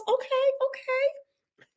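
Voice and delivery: very high, slow sing-song okay; host cooing approval at viewers from Houston. Real, not acted. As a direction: croon it approvingly. Reacting to H-Town viewers.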